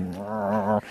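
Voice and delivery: soft mock growl of frustration. Meditator fuming at the noise, soft mock growl. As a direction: growl quietly like an annoyed dog. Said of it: "And I'm like".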